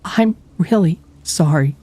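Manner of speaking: high, broken, stammering apology; weeping. A narrator's reading. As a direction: stammer through tears. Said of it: "she wept".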